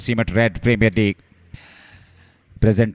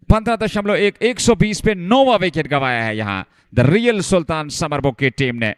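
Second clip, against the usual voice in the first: high, fast-rising wicket call; excited cricket commentary; 5 semitones higher than usual. Real, not acted. Catch taken, wicket falls. (उत्साहित cricket commentary)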